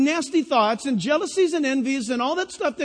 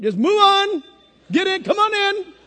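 high, louder, breezy waving through; mock ushering; 7 semitones higher, 4 dB louder than usual. Preacher playing God waving believers into heaven, high and lively. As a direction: usher them in cheerfully, high up.

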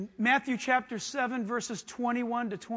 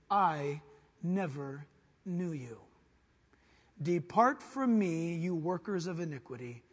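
lower, slow, solemn sentence; slow grave verdict; 4 semitones lower, slower than usual. Acted. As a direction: pronounce judgment with weight. Jesus' verdict, slow and low.

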